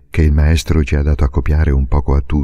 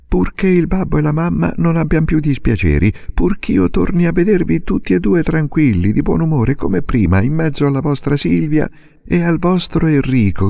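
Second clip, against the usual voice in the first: put-on a girl pleading, voice high and rising; offering everything to her mother; eager young girl Silvia; 11 semitones higher than usual. A narrator's reading. (bambina che supplica con slancio)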